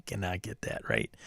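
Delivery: whispering. Real, not acted. Laughing at failed name attempts.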